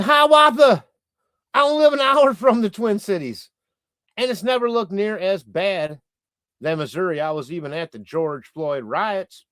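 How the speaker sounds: high put-on voice, loud at first, fading to near plain; funny comment voice. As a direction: read the viewer's comment in a silly voice. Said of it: "put funny accents on you guys when I read the comments".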